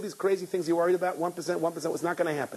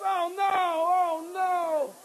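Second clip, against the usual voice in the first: overwhelmed disbelief; much slower than usual. Retold or imitated. Groom overwhelmed, high, slow.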